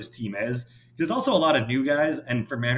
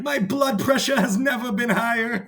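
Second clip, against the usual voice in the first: 1 mock screaming fan; 12 semitones higher, 3 dB louder than usual. Quoting a viral screaming fan.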